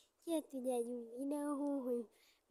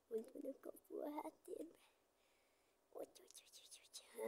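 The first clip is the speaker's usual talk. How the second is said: whispering; 11 dB quieter, faster than usual. Real, not acted. Frustrated muttering while aiming.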